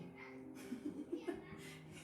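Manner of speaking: breathy chuckle; quiet laugh, mostly breath; amused laugh. Real, not acted. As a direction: laugh at your own blunder. Laughs at own mistake in story.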